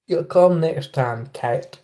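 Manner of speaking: slow Southern simple-man drawl; slow, earnest Southern US voice; Forrest Gump. Pretend. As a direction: drawl it slowly in a Southern accent. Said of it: "I sounded a bit like Forrest Gump".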